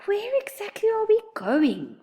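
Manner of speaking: high, whispery squeak; timid high squeak. A narrator's reading. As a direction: squeak it out, nervous. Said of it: "Oliver squeaked".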